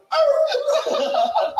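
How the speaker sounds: squealed high yelp while laughing; high scared yelp. Retold or imitated. Dog yelps and flees.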